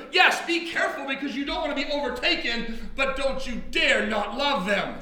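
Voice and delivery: ordinary talk, nothing marked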